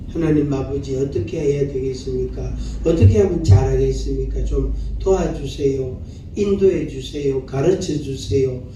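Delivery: low, even-toned prayer; prayerful pleading. Acted. Preacher models asking God for help, prayerful pleading. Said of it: "그래서 하나님께 도움을 구하는 거예요".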